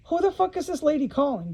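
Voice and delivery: ordinary delivery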